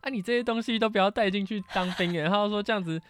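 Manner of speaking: put-on high, fretting old-lady voice; worried grandma. Grandma frets over grandson's earrings before the army, in a high worried voice. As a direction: imitate grandma nagging with anxious care. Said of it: "我奶奶就很紧张".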